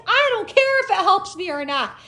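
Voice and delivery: high, loud retort; defiant yelling. Retold or imitated. Driver defying officer's warning about court, yelled retort. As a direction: shout back, refusing to be warned off. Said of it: "And I was yelling too".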